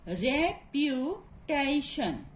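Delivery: REPUTATION slow, with gaps between its syllables. Shown as a demonstration. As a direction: say "reputation" slowly, piece by piece. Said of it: "we will find out the syllable here".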